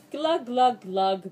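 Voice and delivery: loud gulping glugs; silly glug sounds. Acted. Reader performing the silly song, loud glug sounds. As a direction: gurgle it like drinking.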